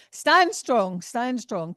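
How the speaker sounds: loud, punchy call to stand firm; forceful rallying cry. Acted. Medium voicing Jill's urging to the sitter, loud and forceful. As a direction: belt it out like a spirit pushing through. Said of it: "She's, she's making my voice go".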